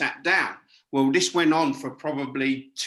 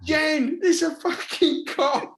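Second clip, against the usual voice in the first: retold disbelief; 10 semitones higher, slower than usual. To Jen, about the elephant art: a high scoffing protest. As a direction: scoff that it's a con, pitched high. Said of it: "And I'm going".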